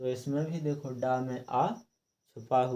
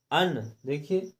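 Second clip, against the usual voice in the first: ordinary talk, nothing marked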